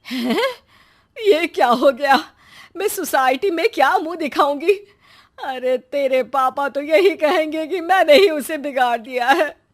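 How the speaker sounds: high-pitched fretting lament; shocked, distressed mother. Acted. Mother fearing society and father's blame, distressed. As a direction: wail in dismay at the scandal.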